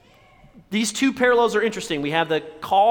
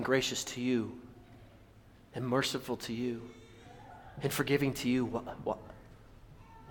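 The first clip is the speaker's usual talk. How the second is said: low, soft, with long pauses; hushed appeal; 5 semitones lower, 12 dB quieter than usual. Real, not acted. Preacher asking the congregation why they hold back, hushed. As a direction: let it sink in quietly and low.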